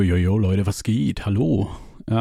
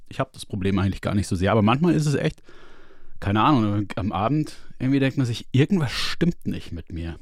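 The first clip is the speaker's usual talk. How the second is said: ordinary delivery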